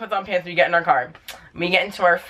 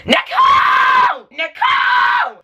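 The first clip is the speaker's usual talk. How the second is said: piercing, drawn-out high shriek; retold scream; 6 dB louder, much slower than usual. Grandma screaming for mom down the hall, retold scream. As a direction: imitate a full-out screech, stretching the name. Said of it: "Like, screaming for my mom in the hall".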